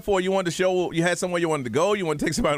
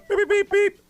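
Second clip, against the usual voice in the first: high-pitched mock scanner beeping; imitated scanner beeps; 14 semitones higher, 4 dB louder, slower than usual. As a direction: mimic a checkout scanner, high and quick.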